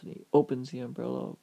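usual speaking voice

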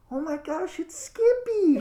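very high, loud crowd squeal; excited fans. Acted. Game master voices the stands greeting another fighter, high loud squeal. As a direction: shriek the name like an overjoyed fan. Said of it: "you hear the people in the stands go".

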